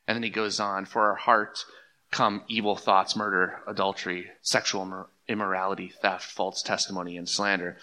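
low, soft recital of a Bible verse; solemn scripture quotation. Acted. Preacher quoting Jesus to the congregation, solemn and hushed. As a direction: recite it gravely, pitch and volume dropped.

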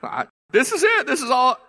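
high, loud panicked plea; desperate cry to God. Retold or imitated. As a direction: cry out like you're at the end. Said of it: "we just go".

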